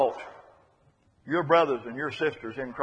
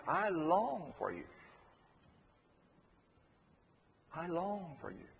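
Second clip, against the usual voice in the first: tender longing; 13 dB quieter than usual. Retold or imitated. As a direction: let "long" linger, hushed and heartfelt. Preacher savours Paul's words of longing.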